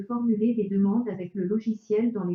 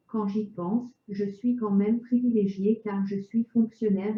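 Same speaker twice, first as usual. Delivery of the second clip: chipper text to speech voice; synthetic voice. Real, not acted. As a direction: speak like a computer speech synthesizer. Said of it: "avec une voix de synthèse".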